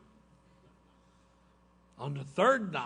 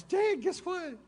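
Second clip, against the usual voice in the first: put-on high excited kid telling news; high child's eager report; little boy voice; 13 semitones higher than usual. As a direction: bubble it out like a thrilled son. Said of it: "He goes".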